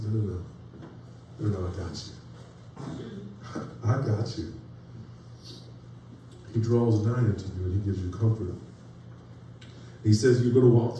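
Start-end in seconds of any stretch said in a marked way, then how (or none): 0.0-4.5 soft, slow, low reassurance with a small laugh; tender voice of God. Acted. God soothing a crushed believer, tender and slow. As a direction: hush a broken heart: I got you.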